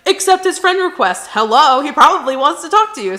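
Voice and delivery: high, loud, insistent urging; acted exasperated friend pushing her to act; urgent best friend.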